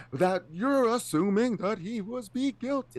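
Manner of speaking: high-pitched, drawn-out mock politician; slow, high, hammed-up imitation; mimicked debate delivery. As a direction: exaggerate it slowly, way up in pitch. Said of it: "That's how he said it".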